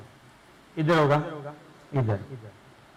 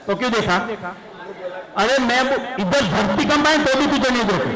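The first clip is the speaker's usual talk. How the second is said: acted angry scolding; 5 semitones higher than usual. Shown as a demonstration. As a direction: build into a mock rant at the student. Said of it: "मैं दिखा रहा हूँ acting".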